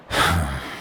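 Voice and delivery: a soft, weary sigh; resigned sigh. Acted. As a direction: sigh as you give up the argument. Chief sighs before giving in.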